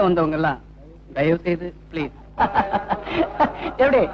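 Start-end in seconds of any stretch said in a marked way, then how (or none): 2.4-3.8 long staccato ha-ha laugh; mocking laugh. Heckler to singer, jeering laughter. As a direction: laugh derisively in a run of short bursts.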